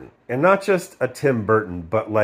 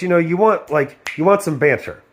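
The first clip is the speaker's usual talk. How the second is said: usual speaking voice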